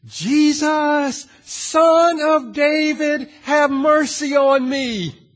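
high, loud, slow desperate plea; crying out. Acted. Blind man begging Jesus from the roadside, loud slow cry. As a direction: call out across the crowd, dragging each word. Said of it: "He cries out".

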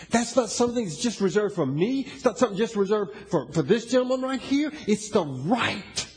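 loud, driving preacher; booming motivational speech. Acted. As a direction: push the words out to the hall. Preaching at full force.

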